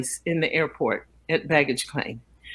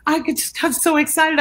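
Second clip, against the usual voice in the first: apologizing fan; 9 semitones higher than usual. Retold or imitated. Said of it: "She said".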